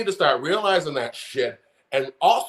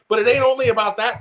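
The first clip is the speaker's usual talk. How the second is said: quick, raised, heated; worked-up fast jab; 6 semitones higher, 4 dB louder, faster than usual. Real, not acted. Calling out Tim Miller, angry and fast. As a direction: snap it out fast and higher. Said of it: "getting worked up today".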